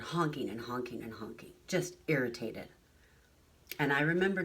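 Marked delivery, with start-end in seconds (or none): none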